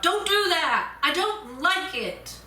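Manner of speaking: mock yelled plea; yelled protest. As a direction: yell out to make it stop. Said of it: "I yelled out".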